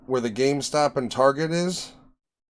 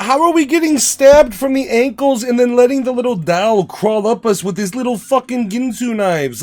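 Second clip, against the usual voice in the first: loud rant; 7 semitones higher, 5 dB louder than usual. Real, not acted. Ranting about horror movie victims.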